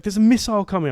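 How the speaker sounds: high urgent cry; panicked mum. Retold or imitated. Mum tells dad the missile is coming, high urgent cry. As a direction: blurt the news in alarm. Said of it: "she's like".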